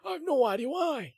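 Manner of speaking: put-on high, loud, wheezy old geezer; out-of-breath old man. Shown as a demonstration. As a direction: strain it out like a winded old man. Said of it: "like, an out-of-breath old man".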